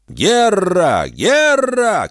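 high distant war cry; shouting from the street. A narrator's reading. Someone in the street shouts the alarm, high yell. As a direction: yell it out from afar. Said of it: "кричали на улице".

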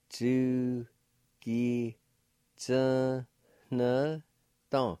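"Chu ki tsu ne dong" broken into separate syllables. Shown as a demonstration. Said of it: "like this".

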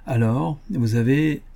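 ordinary delivery

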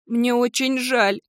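high, drawn-out tearful apology; crying. Acted. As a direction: wail it slowly through tears. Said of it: "заплакала Кендалл". (плачущим голосом)

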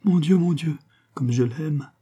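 high theatrical cry of love, sinking at the end; melodramatic, stagey lament; mock sobbing. A narrator's reading. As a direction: wail it for effect, lightly. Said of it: "éclata-t-elle en sanglots en murmurant cette phrase à effet".

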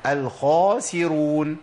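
الخاسرون with rounded lips on خ and a smile on the س; slow model of الخاسرون, heavy خ and light س; heavy kha then smiling sin. Shown as a demonstration. Teacher models the word slowly for students. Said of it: "ابتسامة عشان يجيب السين".

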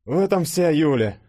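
high, loud teasing remark; wry scoff. A narrator's reading. Denis commenting on his prickly friend, wry scoff. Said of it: "хмыкнул Денис".

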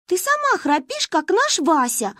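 a hint of defiant girl; cheeky retort. A narrator's reading. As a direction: fight back at the big sister. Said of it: "пошла в бой Манька".

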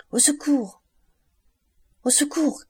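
high-pitched cry for help; appel au secours. A narrator's reading. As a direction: call out in alarm, voice pitched right up. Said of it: "cria mademoiselle d'Armilly". (cri aigu de femme affolée)

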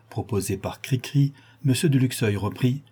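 ordinary delivery, nothing marked

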